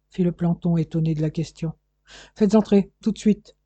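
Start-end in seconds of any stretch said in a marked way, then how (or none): 2.3-3.6 ordre pressant, plus haut et plus fort; ordre sec. A narrator's reading. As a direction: donne l'ordre vite au planton. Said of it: "ordonna monsieur Serpier". (le chef ordonne au planton, ton impératif)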